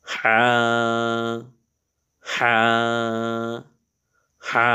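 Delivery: Arabic ḥa, pressed in the throat; throaty ha. Shown as a demonstration. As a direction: squeeze হা in the throat. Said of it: "গলার মধ্যে চেপে করতে হয়".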